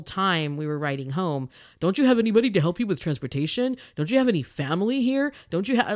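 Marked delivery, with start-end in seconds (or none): 1.8-5.9 louder, quicker, pushy questioning; condescending questions. Retold or imitated. Driver badgering the blind passenger, louder and condescending. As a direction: pester her with patronizing questions.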